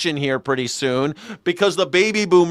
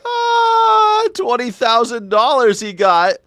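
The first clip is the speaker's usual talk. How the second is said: mock excited shout, higher and louder; 7 semitones higher, 4 dB louder, slower than usual. Mocking the news story.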